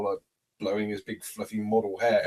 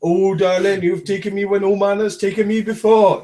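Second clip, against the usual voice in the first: raised, loud theatrical swoon; mock lover; 8 semitones higher, 12 dB louder than usual. Lover to darling in a joke, loud hammed-up swooning. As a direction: overplay a gushing lover.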